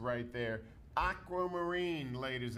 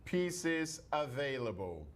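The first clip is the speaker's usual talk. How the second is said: upbeat salesman sales pitch; shopping channel pitch; 3 semitones lower, 11 dB louder than usual. Acted. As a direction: sell it like home shopping TV.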